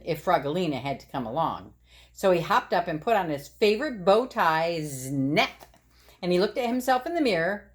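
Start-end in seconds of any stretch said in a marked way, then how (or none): none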